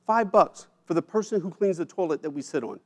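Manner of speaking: usual speaking voice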